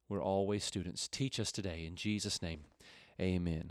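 low, soft praying voice; opening prayer. Real, not acted. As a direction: pray quietly and reverently with pauses.

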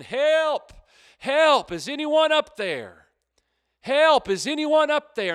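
high, loud desperate calls; yelling for help. Acted. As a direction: call up the cliff for help. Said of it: "He starts yelling".

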